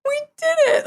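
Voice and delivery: very high, elated cry; retold elation at living in Israel; triumphant joy. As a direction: squeal it like a dream come true.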